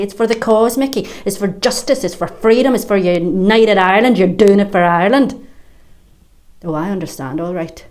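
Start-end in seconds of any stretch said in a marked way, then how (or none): 0.0-5.4 louder, rousing mock speech; recruiters' rallying cry. Mother mocking the men who radicalised her son, rousing. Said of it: "and say".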